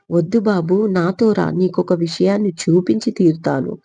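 ordinary delivery

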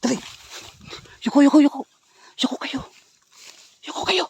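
high, loud urgent cry; urgent shout. Real, not acted. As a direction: yell at them to duck. Urgent call to duck down.

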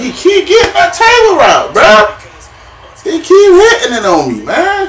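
high, loud complaint breaking into a laugh; exasperated shout. Real, not acted. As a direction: yell it in disbelief, half laughing. Gamer yells at repeated route.

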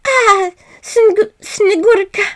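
high, loud, slow tearful cry; crying with joy. A narrator's reading. Marusha embracing her snow child, crying with joy. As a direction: weep over the child with love. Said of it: "she cried".

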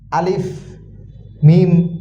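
normal talking voice

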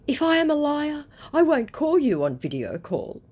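high, loud mock defence; protesting scammer. Scammer defending his honesty, mock indignant. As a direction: argue back in a high put-on voice.

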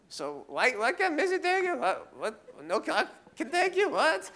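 high-pitched, spluttering mock irritation; imitated pissy dad. Dad driving son to the airport, comically pissy. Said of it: "He's just pissy. He's like".

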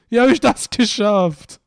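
loud, drawn-out disbelief; baffled outcry. Real, not acted. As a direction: cry out the question, baffled. Repeats the question, more baffled.

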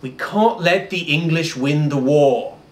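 put-on a light English accent, slightly slow; gravely serious in an English accent; English advisor. The French advisor warns the council in an English accent. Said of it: "his lead French advisor leans forward and says".

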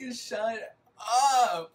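loud high laughing cry of shut up. Real, not acted. Laughing at Maria's line, squealing shut up. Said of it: "And I'm like".

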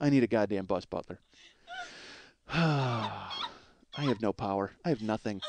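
a mumbled, sighing letdown; deflated. Real, not acted. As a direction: sink into quiet defeat. Deflated after bus butler rant.

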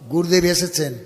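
usual speaking voice

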